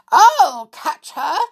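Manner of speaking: loud, high, drawn-out cry; Mother Bear's shout. A narrator's reading. Mother Bear chasing Goldilocks, crying out. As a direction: call it out high and urgent. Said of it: "cried Mother Bear".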